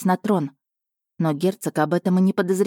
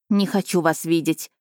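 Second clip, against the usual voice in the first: plain reading voice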